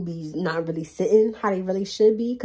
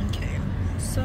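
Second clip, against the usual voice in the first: whisper. Real, not acted. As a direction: hush it, just for yourself. Whispers to herself while out.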